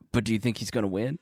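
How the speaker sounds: low, soft, quick worried question; mock worried hush. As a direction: ask it timidly under your breath. Imitating Miss Pat's worried question.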